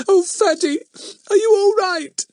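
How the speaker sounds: put-on high, loud child's wail; scared little girl, very high; tearful, near sobbing. A narrator's reading. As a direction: plead anxiously through tears. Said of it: "She began to sob".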